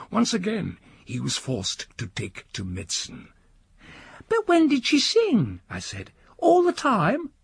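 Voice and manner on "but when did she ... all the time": put-on a high, eager boy begging his uncle; the nephew keeping the old man awake; young boy nudging sleepy uncle, high and pleading; young boy's voice. Boy asks about singing.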